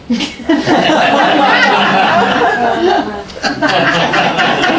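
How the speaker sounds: big high laugh breaking out; bursting laugh. Real, not acted. Guest laughing while telling host the story. Recalling Bob's hug gesture.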